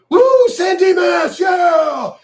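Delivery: high, drawn-out hollered whoop; mock rally cheer. Streamer mocks how these Democrats run their speeches, mock cheering shout. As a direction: whoop it up like an idiot jock firing up a crowd. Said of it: "All they're doing is going".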